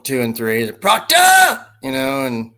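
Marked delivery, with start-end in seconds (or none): 0.7-1.6 high, loud shouted "Proctor!" like the film; imitating a movie shout. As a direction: belt out "Proctor!" as the character. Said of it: "asked him to say Proctor like he did".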